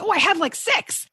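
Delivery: high-pitched excited boast; mock-excited, pitch shooting up; eager self-quote. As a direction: blurt it like a thrilled gadget collector. Quotes own eager reaction to mic.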